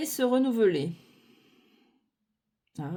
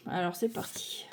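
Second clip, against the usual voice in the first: whisper; 2 semitones lower, 6 dB quieter than usual. Real, not acted. Whispered aside before new suit.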